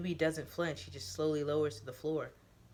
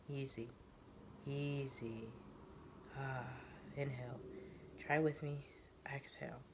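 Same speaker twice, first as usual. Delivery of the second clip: soothing breath coaching; 2 semitones lower, 11 dB quieter than usual. Acted. Newbie guides Teo's breathing, soft and soothing. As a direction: ease him down breath by breath.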